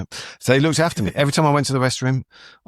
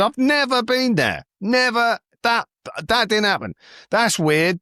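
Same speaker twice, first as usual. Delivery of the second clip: high-pitched mock protest; indignant denial; 11 semitones higher than usual. Comedian replays denying it to the woman, indignant. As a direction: deny it in a high, flustered retelling. Protesting he was never there.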